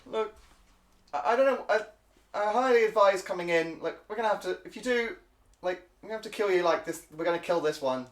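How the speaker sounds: squeaky stammering guard; kobold voice. Acted. Kobold guard threatening to kill the party: high squeaky voice. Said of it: "they go".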